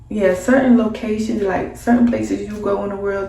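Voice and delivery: normal talking voice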